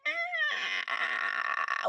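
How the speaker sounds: high creaky strained ah like a door hinge; creaking groaning door voice. Shown as a demonstration. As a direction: squeeze a creaking door sound. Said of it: "But you sound like a creaking door".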